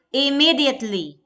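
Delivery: immediately, loud on its second syllable; stress on second syllable. Shown as a demonstration. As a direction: lean hard on the "me" in immediately. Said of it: "Me is the stress syllable".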